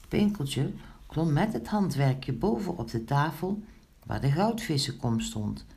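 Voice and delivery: ordinary delivery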